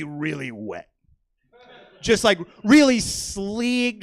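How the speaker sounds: slow, sultry drawl on sleek; drawn-out sultry. Acted. Comic describing sexy aliens, slow sultry drawl. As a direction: stretch it out like a seductive purr.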